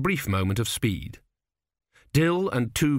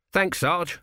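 higher, grateful lad's voice; young recruit; 7 semitones higher than usual. Acted. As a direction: thank him like a relieved green soldier. Narrator voices Autocue.

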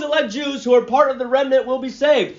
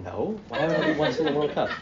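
laughing; 6 semitones lower, 9 dB quieter than usual. Retold or imitated. Retelling his own reply, laughing.

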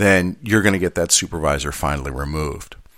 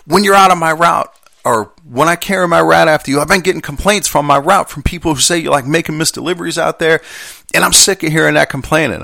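heated, high-pitched accusing rant; pissed-off coworker; 8 semitones higher, 4 dB louder than usual. Retold or imitated. Carrier accuses substitute of causing route complaints, acted angry. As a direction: imitate an angry man airing his grievance. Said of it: "he's even more pissed off".

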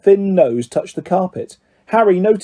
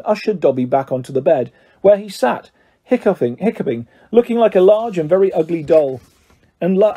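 usual speaking voice

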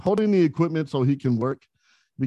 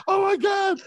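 much higher, mock starstruck squeal; starstruck gasp; slower than usual. Restaurant staff spotting their hero in the car, starstruck squeal. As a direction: shriek like a fan seeing their idol. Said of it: "Everybody from the kitchen came back".

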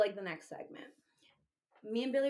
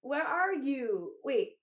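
slow, louder, drawn-out demand; mock-indignant, higher and slow; demanding drunk text; 4 semitones higher, 5 dB louder, slower than usual. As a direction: stretch each word like a needy text. Reading own drunk texts.

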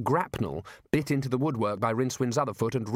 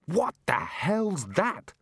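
high-pitched, drawn-out outcry; alarmed yell; 7 semitones higher, much slower than usual. A narrator's reading. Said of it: "he yelled".

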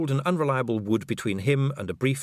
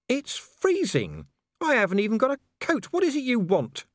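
much higher, rushed and pleading; a freezing man begging for answers; desperate; 14 semitones higher than usual. Acted. Vimes freezing in the snow, pleading high and rushed.

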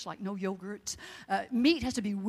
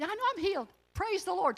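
much higher, exultant praise; joyful praise; 10 semitones higher than usual. Retold or imitated. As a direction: rejoice at the doctor's news. Answering doctor, praising God.

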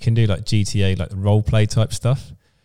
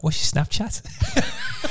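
very high, slow, laughing squeak; cheeky lad asking for her Snapchat, laughing; mock Scouse lad; 8 dB quieter, much slower than usual. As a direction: drag it out through laughter. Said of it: "He's like".

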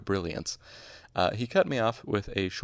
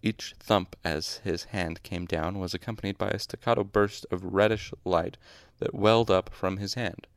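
usual speaking voice

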